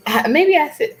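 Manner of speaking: playful, high and sing-song; teasing high voice. Real, not acted. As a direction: tease her with a bright high lilt. Teasing partner about choosing questions.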